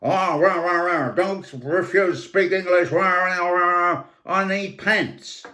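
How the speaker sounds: loud, high hammed-up American accent with rah rah; American version. American demanding pants from the Frenchman, hammed-up American accent. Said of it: "the American version".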